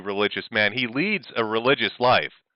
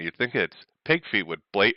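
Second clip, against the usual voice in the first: ordinary talk, nothing marked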